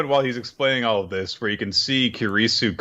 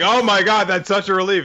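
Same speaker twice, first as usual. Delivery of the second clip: high, loud gush of relief; relieved exclamation; 5 semitones higher, 4 dB louder than usual. Retold or imitated. Customer getting her shoulders worked, gushing with relief. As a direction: exclaim it in mock ecstasy.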